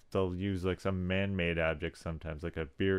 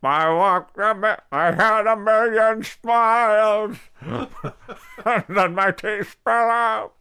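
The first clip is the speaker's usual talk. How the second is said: higher, louder, slow crooning [name]; [name] impression; 12 semitones higher, 10 dB louder, slower than usual. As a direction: belt it like an old [name] crooner. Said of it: "more like [name]".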